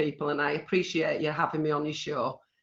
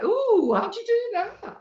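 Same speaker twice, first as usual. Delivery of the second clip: high, wide-eyed mock astonishment; amazed onlookers; slower than usual. Woman retelling friends' reaction to her nose trick, high-pitched amazement. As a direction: squeal it like an impressed crowd. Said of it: "And everybody went".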